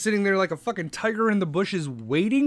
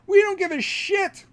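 much higher, louder dismissive mock voice; mock China; 12 semitones higher, 4 dB louder than usual. China brushing off John Kerry, high mocking brush-off. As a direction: toss it off like a cocky kid. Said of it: "and China was like".

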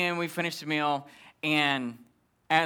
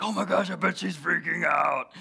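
tag; 2 semitones higher than usual. Retold or imitated. Voices gleeful buddy on phone.

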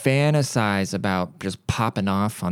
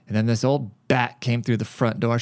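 usual speaking voice

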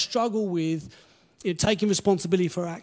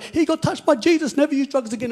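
excited testimony; 8 semitones higher, 8 dB louder than usual. Retold or imitated. Mock testimony quote in sermon.

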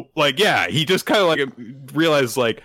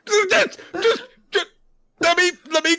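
much higher, pleading villain whine; whiny villain voice. Retold or imitated. Momoshiki pleading to take Boruto's body, a high whine. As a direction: beg like a sore loser.

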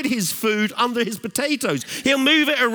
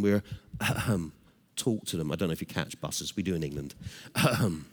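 low, soft reflective sermon voice; hushed preaching; 12 semitones lower, 7 dB quieter than usual. Real, not acted. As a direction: draw them in gently, low and quiet. Turns to hushed, solemn preaching.

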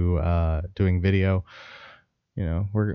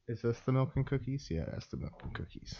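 whisper; 3 semitones higher, 16 dB quieter than usual. Real, not acted. Whispered aside while dabbing.

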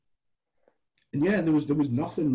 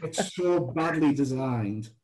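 disgusted; slower than usual. Real, not acted. Ranting about the wasteful network.

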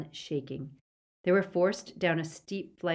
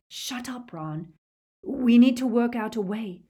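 urgent hushing; 6 semitones higher, 7 dB louder than usual. A narrator's reading. Boy urges yelling friend to think, urgent hushing. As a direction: hush him, then press the point.

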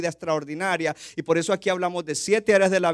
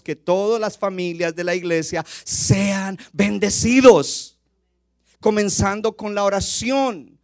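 slow, louder preaching climax; preacher urging the congregation, building louder; rising preaching; 2 semitones higher, 7 dB louder, slower than usual. Acted. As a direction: build the call to bless the church, slowly and louder.

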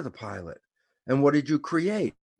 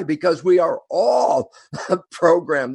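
laughing; 4 semitones higher, 6 dB louder, slower than usual. Real, not acted. Laughs mid-line while explaining.

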